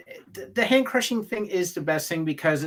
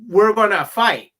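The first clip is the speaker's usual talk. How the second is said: loud, raised mock challenge; pseudo-aggressive threat; 6 semitones higher, 9 dB louder than usual. Fan spells out what Superman's invitation means, mock aggressive threat. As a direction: put on a fighting challenge, loud and high. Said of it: "like a pseudo-aggressive threat".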